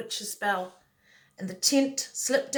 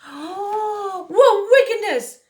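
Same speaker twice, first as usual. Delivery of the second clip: shocked gasp; 12 semitones higher, 8 dB louder, much slower than usual. Acted. David discovers the broomstick trick.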